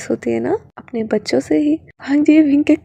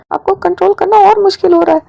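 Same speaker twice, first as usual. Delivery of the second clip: higher, louder exasperated scold; 6 semitones higher, 7 dB louder, faster than usual. Acted. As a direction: scold him, voice rising. Wing scolds rising. (झल्लाकर ऊँची आवाज़ में डाँटना)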